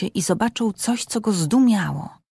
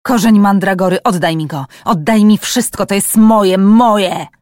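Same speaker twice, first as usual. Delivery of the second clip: much louder, grasping, possessive demand; furious greedy scream; 8 dB louder than usual. A narrator's reading. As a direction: scream moje like a madman. Attacker screams for the mandrake root. (wściekły, chciwy krzyk, dużo głośniej)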